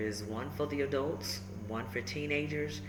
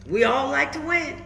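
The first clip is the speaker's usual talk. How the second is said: put-on lively, rhyming read-aloud for kids; storybook read-aloud; 10 semitones higher, 14 dB louder than usual. A narrator's reading. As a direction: bounce the rhymes like a picture book. Reads picture book aloud.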